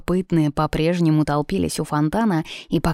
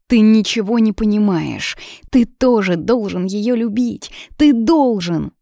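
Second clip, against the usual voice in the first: raised, urgent pleading; heated insistence; 4 semitones higher, 4 dB louder than usual. A narrator's reading. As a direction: insist emotionally, pitched up and louder. Heated quarrel, she insists he must love.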